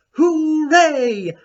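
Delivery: high, loud burst of joy; excited cheer. Acted. Principal's note read out to Maggie, a joyful high cheer. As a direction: throw out a big hooray.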